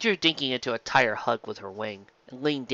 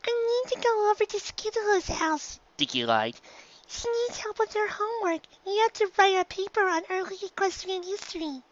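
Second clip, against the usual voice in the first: high filly voice, casual fib; young filly Dinky, fibbing. A narrator's reading. Filly lying to her mother, high and casual.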